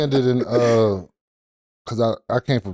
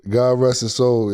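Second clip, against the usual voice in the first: ordinary talk, nothing marked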